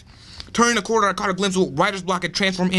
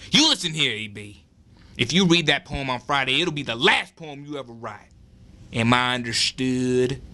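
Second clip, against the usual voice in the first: put-on heavy Southern accent; 4 semitones lower than usual. Bully threatens narrator.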